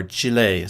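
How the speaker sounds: Chile said as CHEE-lay; Spanish-style pronunciation. Real, not acted. Streamer correcting himself to a viewer from Chile, Spanish-style pronunciation. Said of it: "Probably pronounced Chile".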